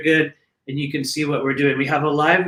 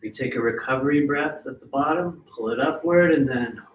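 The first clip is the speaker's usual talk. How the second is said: freediver breathing; 3 semitones lower, 4 dB quieter than usual. Acted. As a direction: count them down calmly, then breathe. Leads a breathing exercise.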